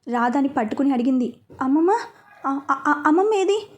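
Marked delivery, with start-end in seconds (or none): 1.6-3.7 high, urgent call for grandma; frantic, higher cry of అమ్మమ్మ; panicked calling. A narrator's reading. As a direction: search the room in panic, calling her. Waking confused, searching for grandma.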